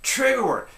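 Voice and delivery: "trigger word" punched high and loud; stress on trigger word. Shown as a demonstration. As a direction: hammer the phrase home. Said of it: "emphatic recap".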